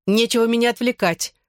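lashing out. A narrator's reading. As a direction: round on the hecklers, pitch lifted. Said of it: "накинулась на них Лиззи".

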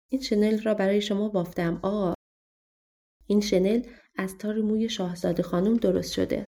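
ordinary delivery, nothing marked

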